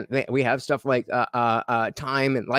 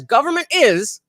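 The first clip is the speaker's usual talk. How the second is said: announcing loudly; 11 semitones higher, 7 dB louder than usual. Real, not acted. Reading the poll aloud.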